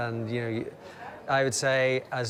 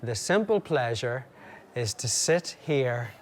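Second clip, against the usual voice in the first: normal talking voice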